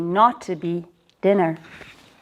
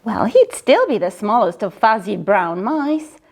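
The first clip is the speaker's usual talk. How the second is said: ordinary delivery, nothing marked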